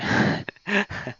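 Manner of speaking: breathy laugh. Real, not acted. Laughs after remembering the name.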